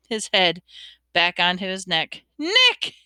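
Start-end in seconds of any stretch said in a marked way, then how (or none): 2.4-3.0 high, hearty shouted greeting; roaring. A narrator's reading. Headless huntsman hailing his rival, roaring. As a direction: roar the name in greeting. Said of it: "he roared".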